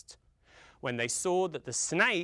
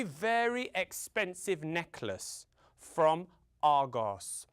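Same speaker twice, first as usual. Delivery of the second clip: plain reading voice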